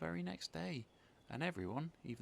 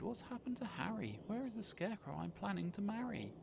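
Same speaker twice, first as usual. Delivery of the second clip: worried; 10 semitones higher than usual. Acted. Betty worried for Harry.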